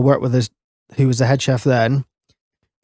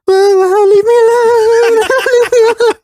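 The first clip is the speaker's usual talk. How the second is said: loud, high-pitched fake wailing; pretend crying; 7 dB louder, slower than usual. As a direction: wail and beg like a hammed-up crybaby. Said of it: "I pretended to cry".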